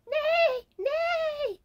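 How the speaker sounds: very high, drawn-out comic cry; acted yelling. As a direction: stretch each word into a high mock yell.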